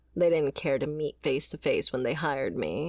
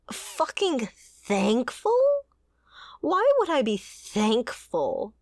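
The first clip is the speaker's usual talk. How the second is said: slow, drawn-out, hurt fucking thankful?, rising then falling; slow bitter disbelief; 6 semitones higher, slower than usual. Acted. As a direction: spit the words out slowly through hurt. Ex-bodyguard hurt by her employer.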